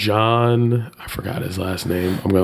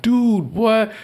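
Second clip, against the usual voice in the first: much higher panicked "Dude, what?"; retold freak-out, pitched way up; 13 semitones higher than usual. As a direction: yelp it in panicked disbelief. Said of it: "'cause I'm freaking out".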